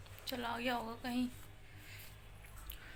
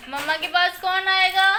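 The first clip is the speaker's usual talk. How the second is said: higher, louder, slow, whiny mock crying; hammed-up weepy mother calling the child; fake crying plea; 8 semitones higher, 14 dB louder than usual. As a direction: pretend to cry for the baby's attention. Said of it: "झूठी की रोने की acting".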